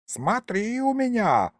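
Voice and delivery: high, slow warning from an ancient ghost; the ancient sorcerer warning Fedka; threatening old sorcerer. A narrator's reading. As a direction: wag a finger in your voice. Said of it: "погрозил древний колдун пальцем".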